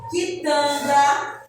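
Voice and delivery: "quitanda" drawn out as ki-tan-da, no u; slow syllables. Shown as a demonstration. Teacher spelling out "quitanda" slowly for children. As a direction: stretch "quitanda" slowly, u silent. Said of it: "Na palavra também".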